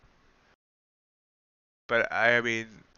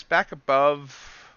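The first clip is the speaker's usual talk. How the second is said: slow drawn out; 4 semitones higher, 4 dB louder, much slower than usual. Shown as a demonstration. Trader reading a chart level to viewers, drawn out slowly. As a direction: stretch out back above, slow and hesitant. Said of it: "I was gonna say back above C P I".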